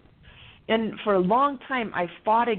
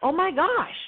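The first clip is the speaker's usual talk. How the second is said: amazed disbelief; 9 semitones higher than usual. Retold or imitated. Retelling her ex's sudden politeness, high delighted surprise. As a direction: marvel that he's suddenly polite. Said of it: "I was like".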